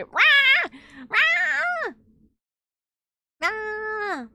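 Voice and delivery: high, drawn-out cat yowl; imitated cat scream. Showing chat how the cat screams, high mock yowl. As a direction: mimic a screaming cat in short bursts. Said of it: "She just, she screams".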